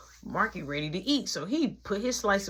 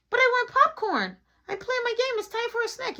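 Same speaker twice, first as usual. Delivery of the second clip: put-on high childish whine for a snack; whiny kid. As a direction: beg like a little brother. Marky whining for popcorn.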